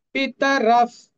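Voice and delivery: "পিতরফ" loud, with short syllables and final f; short vowels. Shown as a demonstration. Teacher showing short vowels, loud "পিতরফ". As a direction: project "পিতরফ", keeping each vowel short. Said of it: "সবগুলি হর্ষ".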